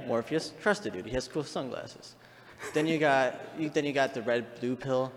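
ordinary talk

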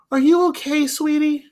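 put-on high, sweet old lady. Old woman fretting over a boy, high and sweet. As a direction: coo it like a worried grandma. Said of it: "she's like".

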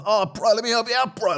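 lightly voiced party bro, high and bright-free; a hint of a chummy bro offering help; mild mock bro dude. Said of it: "sort of full bro down line".

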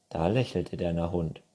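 usual speaking voice

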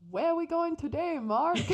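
high, loud mock Irish lilt; awful Irish accent. Fan imitating [name]'s catchphrase in a terrible Irish accent. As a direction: ham up a bad Irish accent. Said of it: "That was an awful Irish accent".

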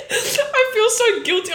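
high laughing confession. Real, not acted. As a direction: laugh through it, embarrassed.

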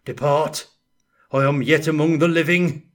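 usual speaking voice

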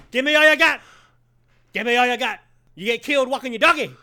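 high, hammed-up shouted demands; Al Pacino in Heat. Co-host performing movie lines for listeners, manic high-pitched yelling impression. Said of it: "my Al Pacino and Heat impersonation".

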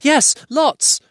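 high, loud panicked answer; squeaky scared little voice; frightened hobbit. Acted. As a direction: blurt it out in fright. Frightened Bilbo answers troll.

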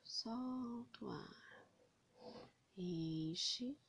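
slow, soft meditation voice; slow breath counting. Acted. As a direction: guide each breath in and out, slowly. Guides breathing slowly.

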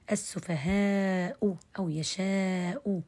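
السفهاء drawn out slowly with the hamza softened between sounds; teacher slowly modelling السفهاء and يشاء; eased hamza. Shown as a demonstration. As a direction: stretch السفهاء, easing the hamza. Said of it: "التسهيل بين بين".

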